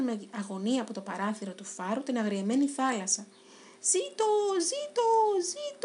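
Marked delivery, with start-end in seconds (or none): 3.8-5.9 much higher, slow cheering chant; celebrating cheer. Acted. Three friends cheering as the fishing boat sails safely away. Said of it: "πανηγύρισαν".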